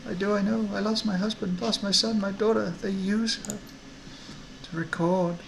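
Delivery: high-voiced, grieving mother; tearful woman. Woman telling strangers of her lost family, acted tearfulness. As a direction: hold back tears in a high, acted voice. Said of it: "her eyes well up in tears".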